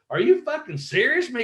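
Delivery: high-pitched disbelieving question; retold incredulity. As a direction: re-enact stunned disbelief, voice jumping up. Retold disbelief at booking news.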